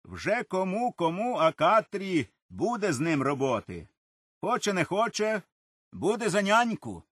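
playful teasing, pitch up. A narrator's reading. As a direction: tease the little girl fondly. Father teasing Katria. (батько жартома дражнить Катрю, грайливо)

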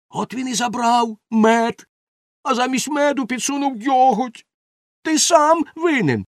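high, rising accusation; accusing cry. A narrator's reading. Helper blames the baker for the swap, accusing cry. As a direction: build up to pinning the blame. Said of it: "закричав Бошбермак".

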